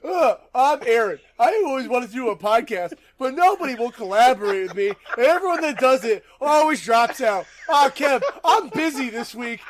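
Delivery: high, loud mocking whine of a flaky co-host; Aaron impression. Host mocking his absent co-host Aaron, high whiny impression. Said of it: "I'm gonna do my best Aaron impression".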